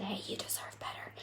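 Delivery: quick breathy whisper of encouragement; inner whisper. Retold or imitated. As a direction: whisper it fast like a small voice nudging you. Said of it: "that whisper to come up".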